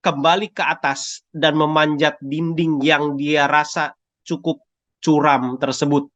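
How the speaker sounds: ordinary delivery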